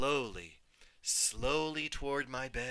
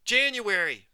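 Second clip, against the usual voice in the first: put-on high, loud child yell; yelled name; 8 semitones higher, 9 dB louder than usual. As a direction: shout it out like a little kid. I'd yell when I was little.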